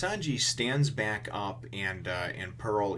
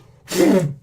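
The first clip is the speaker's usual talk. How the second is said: loud laugh burst; 10 semitones higher, 14 dB louder than usual. Retold or imitated. Luffy's attack, laughing burst.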